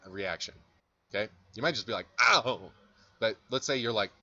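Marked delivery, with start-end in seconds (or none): 2.2-2.7 loud yelp of pretend pain; mock pained "ow". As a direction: yelp as if your foot was trodden on. Imagined student reacts to stepped foot.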